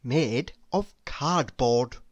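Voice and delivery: ordinary delivery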